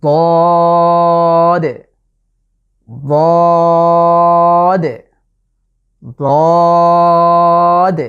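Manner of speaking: বদ said thick and loud, tongue edge against the molars; drawn-out heavy বদ; Arabic letter dad. Shown as a demonstration. Teacher showing viewers the letter dad, a loud thick বদ. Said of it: "এটার নাম হলো".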